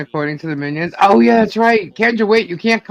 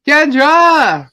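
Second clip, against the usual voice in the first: calling out; 9 semitones higher, 7 dB louder than usual. Real, not acted. Calling a friend onto stream.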